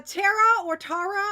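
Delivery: "Tara" said two ways, unsure; two tries at Tara. Shown as a demonstration. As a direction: offer "Tara" in two alternative pronunciations. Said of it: "I don't know how to pronounce it".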